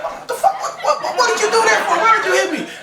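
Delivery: put-on very high squealing kid voice; child's shriek. Boy whooped by his mama, shrieking high in protest.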